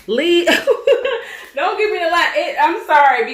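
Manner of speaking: high laughing protest. Real, not acted. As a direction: laugh through the words. Laughing at child's antics.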